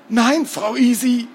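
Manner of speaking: high, groaned denial; groaning. A narrator's reading. Bound captive answers his interrogator, groaning. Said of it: "ächzte Morten".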